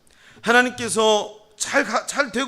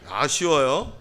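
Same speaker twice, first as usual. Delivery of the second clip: ordinary delivery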